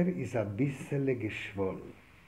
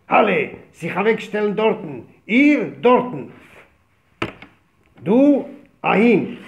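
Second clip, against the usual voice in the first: officer's order; 5 semitones higher, 10 dB louder than usual. Retold or imitated. Officer ordering everyone aside.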